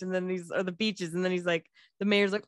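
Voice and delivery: ordinary talk, nothing marked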